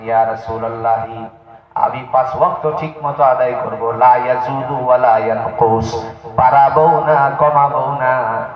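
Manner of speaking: ordinary talk, nothing marked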